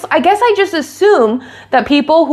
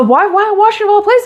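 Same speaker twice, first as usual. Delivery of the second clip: baffled fan; 9 semitones higher, 3 dB louder than usual. Retold or imitated. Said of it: "she's like".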